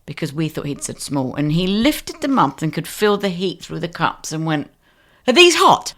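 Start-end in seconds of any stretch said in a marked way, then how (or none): 5.3-5.9 high, loud startled question; surprised customer. Retold or imitated. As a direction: mimic the customer's loud, shocked pickup of the cups. Customer surprised by hot cups.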